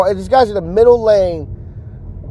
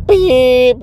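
high, loud mock car beep; horn imitation; 7 semitones higher, 4 dB louder than usual. Honking at the phone-distracted driver, mock horn beep.

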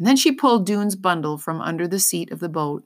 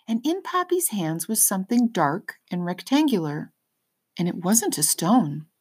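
usual speaking voice

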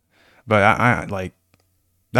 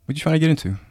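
mock confrontation; 7 semitones higher, faster than usual. Play confrontation, later admits just joking.

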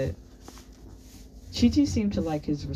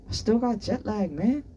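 put-on high, casual American-accented complaint; American accent, pitched up and casual; strong American accent; 7 semitones higher than usual. A narrator's reading. Jet-lagged boy excuses himself, strong American accent. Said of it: "spoke with a strong American accent".

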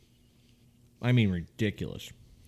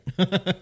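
burst of laughter; 9 semitones higher, 6 dB louder than usual. Real, not acted. Forgot they were recording.

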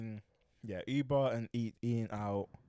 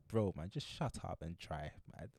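inner grumble; 4 semitones lower, 7 dB quieter, slower than usual. Retold or imitated. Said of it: "but I was like".

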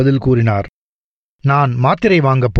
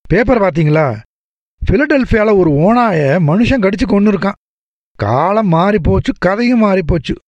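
higher, chatty doctor voice, breezy and teasing; jovial doctor; 5 semitones higher than usual. Acted. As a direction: play the cheerful family doctor gossiping. (உற்சாகமான doctor குரல்)

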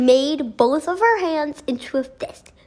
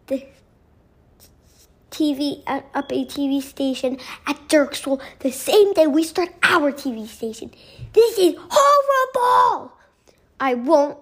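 angry shout; 4 semitones higher than usual. A narrator's reading. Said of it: "started shouting".

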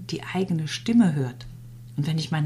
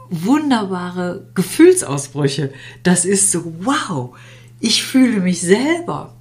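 excited; 3 semitones higher, 5 dB louder than usual. Acted. Describing joyful emotional bursts.

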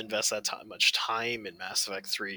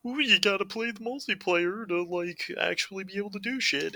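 mocking game voice; 10 semitones higher than usual. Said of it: "it's all linked to".

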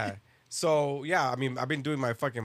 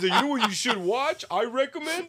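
higher-pitched mock recommendation; mocking imitation; 6 semitones higher than usual. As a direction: lightly exaggerate your friend's way of talking. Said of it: "I didn't even talk like that".

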